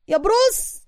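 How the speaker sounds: high call of بروس rising at the end. A narrator's reading. Suka calls after Bruce running off, high rising call. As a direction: call بروس after him, pitch climbing up. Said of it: "وسوكا ينادي عليه".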